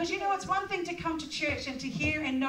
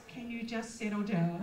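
calm reassurance; 5 semitones lower, 5 dB quieter than usual. Retold or imitated. God's calm reply voiced.

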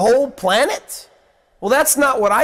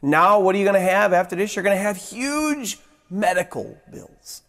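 ordinary talk, nothing marked

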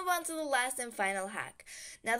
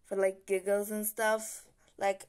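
ordinary talk

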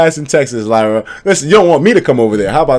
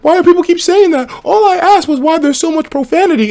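much higher, baffled newcomer voice; confused newcomer; 4 dB louder than usual. Retold or imitated. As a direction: play a lost new viewer asking what it means. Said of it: "new people will be like".

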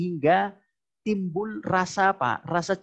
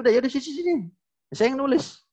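high-pitched mock giving in; exasperated husband; 9 semitones higher, faster than usual. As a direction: imitate a husband impatiently taking over. Acts husband giving in to wife. (meniru suami yang pasrah tak sabar, nada tinggi)

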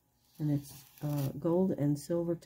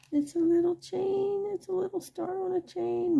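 squeaky high cooing over a tiny chain; high cutesy voice; 12 semitones higher than usual. Real, not acted.

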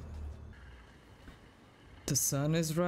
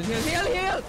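high, loud urgent yelling; panicked shout; 12 semitones higher, 5 dB louder than usual. Real, not acted. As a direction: scream for a heal.

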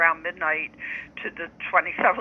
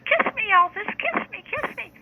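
yelled out. Retold or imitated. Girl in the crowd yelling at [name], high mock yell. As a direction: squeal it like a starstruck fan. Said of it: "one of the girls yelled out".

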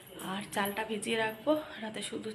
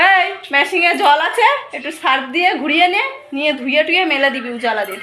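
loud, higher call across the house; 5 semitones higher, 16 dB louder than usual. Real, not acted. Mother calling to daughter across the house, loud call. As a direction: call out the chores so she hears from another room.

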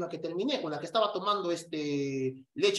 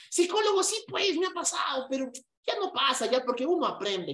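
high, mock student voice answering; squeaky imitation of a student admitting it; voiced student; 6 semitones higher than usual. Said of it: "voiced student reply". (voz aguda imitando a un alumno)

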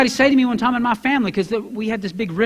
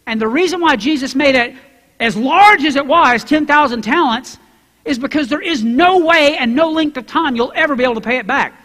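ordinary delivery

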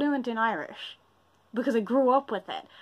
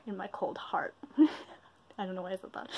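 whispered, softer, a little embarrassed; 4 semitones lower, 7 dB quieter than usual. Real, not acted. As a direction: drop to a whisper as if confessing. Embarrassed aside to camera.

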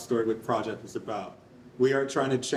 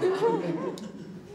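quick giggle; short high laugh. Real, not acted. Presenter giggles while restarting the pitch, high laugh. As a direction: giggle high mid-sentence.

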